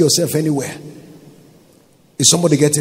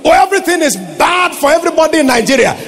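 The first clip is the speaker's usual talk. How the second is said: fast, laughing mock of the complainers; mocking complaint; 11 semitones higher, 4 dB louder, faster than usual. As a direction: mimic the gloom with a laugh. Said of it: "don't say what they say".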